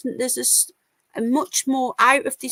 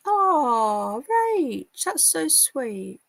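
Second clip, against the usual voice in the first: touched cooing; 4 semitones higher, much slower than usual. Real, not acted. Streamer thanking a viewer in chat, touched and cooing. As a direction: coo gratefully at a kind message.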